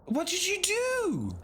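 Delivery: high, slow, pointed question; high slow accusing voice. Acted. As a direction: drag it out in a high voice. Pastiche accusing.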